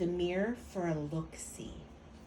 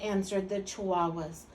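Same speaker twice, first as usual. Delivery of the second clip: usual speaking voice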